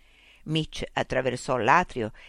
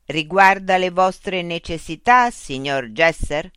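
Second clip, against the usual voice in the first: machine voice; 3 semitones higher, 7 dB louder, slower than usual. Acted. Loudspeaker robot voice addressing Mitch. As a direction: speak as a polite, mechanical information unit.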